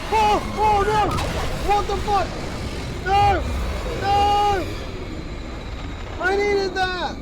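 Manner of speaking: high frantic angry yelling; raging gamer. Real, not acted. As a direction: shout it in frantic outrage. Gamer raging at being attacked.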